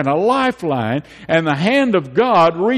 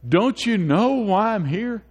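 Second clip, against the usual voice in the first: pleading John the Baptist; 8 semitones higher than usual. Retold or imitated. John the Baptist pleading with his disciples, high and earnest. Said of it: "He says".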